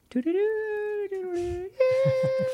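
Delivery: high sung flute flourish, doo-doo-doo; imitated flute trill. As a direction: hoot out a rising mystical flute motif. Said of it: "flutes are lightly playing".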